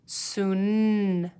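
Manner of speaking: "Soon" with a long, held N; final sound drawn out. Shown as a demonstration. Teacher helping pupils hear the last letter, final sound drawn out. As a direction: stretch the end of "Soon" into a hum. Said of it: "What letter is at the end".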